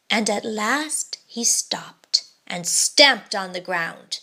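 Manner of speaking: ordinary delivery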